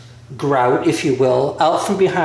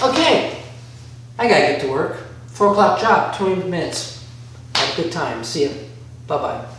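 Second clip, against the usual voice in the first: usual speaking voice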